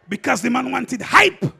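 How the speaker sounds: ordinary talk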